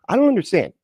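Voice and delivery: high, quick mock-baffled voice; mock bafflement. Streamer mimicking a believer who cannot follow the doubt, mock bafflement. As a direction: pitch it way up like a naive believer.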